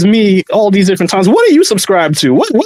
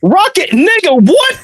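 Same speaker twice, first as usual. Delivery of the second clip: incredulous; 8 semitones higher, slower than usual. Real, not acted. Co-host hearing an absurd WrestleMania example, incredulous. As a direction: pitch leaping up in disbelief.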